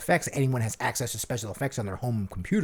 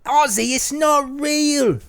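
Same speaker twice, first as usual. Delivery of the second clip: high, slow, scolding Sharon; high slow Sharon reprimand; Sharon impression; 12 semitones higher, 5 dB louder, much slower than usual. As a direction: correct him in a high Sharon voice. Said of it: "play all three parts".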